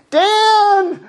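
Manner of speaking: high shout of the name; high piercing call; wife calling out. Retold or imitated. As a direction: yell his name like a worried wife. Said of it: "Like Rachel".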